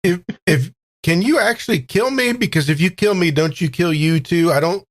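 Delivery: ordinary talk, nothing marked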